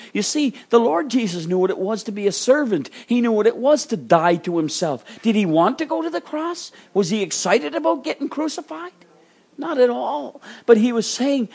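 high, insistent sermon delivery; urgent preacher in full flow; impassioned preaching. Real, not acted. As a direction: press the rhetorical questions on the congregation. Preaches at raised pitch.

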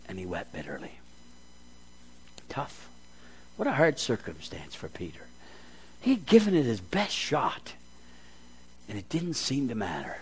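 ordinary delivery

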